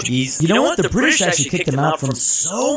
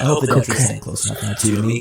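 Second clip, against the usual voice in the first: ordinary delivery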